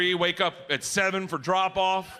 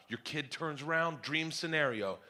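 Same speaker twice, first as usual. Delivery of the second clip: ordinary talk, nothing marked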